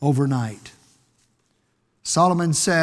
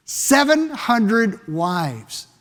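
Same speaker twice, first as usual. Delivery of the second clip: "Seven hundred wives" drawn out word by word; slow emphasis; 2 semitones higher, much slower than usual. Shown as a demonstration.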